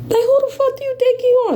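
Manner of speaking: indignant high-pitched rant; outraged high outburst. Real, not acted. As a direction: fire it off high and fast in outrage.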